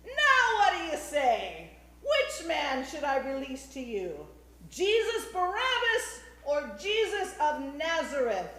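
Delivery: shouted demand. A narrator's reading. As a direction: put it to the mob at full voice. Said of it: "And Pilate shouted".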